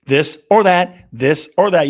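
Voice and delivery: slow, loud drawn-out "this or that"; game show call. Acted. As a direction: belt it like a game show host. Host launching quiz segment.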